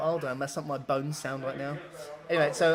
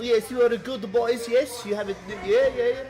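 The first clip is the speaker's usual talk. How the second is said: put-on high, loud Eastern European accent; 8 semitones higher, 7 dB louder than usual. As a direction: imitate an eager Eastern European stranger. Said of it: "default Eastern European accent".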